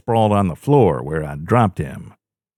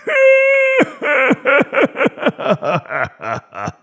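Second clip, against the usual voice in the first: cruel inhuman cackle. A narrator's reading. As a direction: cackle like a madman. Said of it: "inhuman cackle".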